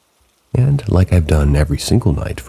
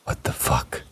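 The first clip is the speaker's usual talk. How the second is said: breathless whispered disbelief; shocked whisper; 10 dB quieter than usual. A narrator's reading. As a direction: whisper it, stunned and chilled. Reaction to the child's creepy answer.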